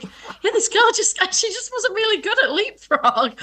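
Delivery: high, laughing imitation of a crying child; mock crying child. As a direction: play yourself as a tearful seven-year-old, half laughing. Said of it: "I'm, like, crying".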